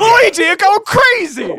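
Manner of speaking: high laughing cry; laughing mock yell. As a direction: laugh through a mock shout.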